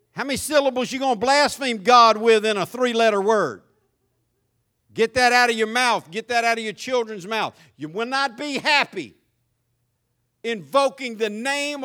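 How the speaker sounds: stern rebuke. Real, not acted. Preacher rebuking the congregation.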